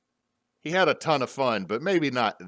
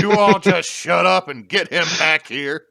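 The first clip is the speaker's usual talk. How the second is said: loud, raised-pitch dwarf ordering everyone; shouting dwarf; 8 semitones higher, 10 dB louder than usual. Pretend. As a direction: bark it out as a fed-up dwarf, hammed up.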